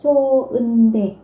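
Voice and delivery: chô ưn đè said very slowly, each syllable apart, with no linking sound; slow teacher demo of chô ưn đè; slow pronunciation demo. Shown as a demonstration. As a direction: spell out chô ưn đè slowly for students. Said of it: "các bạn sẽ phát âm là".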